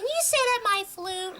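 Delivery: high, slow mock student voice; imitated flute player. Flute player asking the band teacher, high mocking imitation. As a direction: put on a high, drawn-out asking voice.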